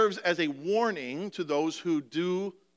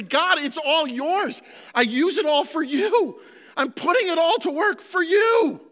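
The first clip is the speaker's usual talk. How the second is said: prayer of surrender; 9 semitones higher, 7 dB louder than usual. Shown as a demonstration. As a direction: shout the surrender to God. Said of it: "and just say".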